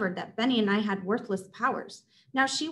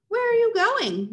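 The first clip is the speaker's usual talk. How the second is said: put-on higher, slower kid brother asking; Benny's high voice; 12 semitones higher, 5 dB louder than usual. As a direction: ask it up high and slowly. Benny asks, high and slow.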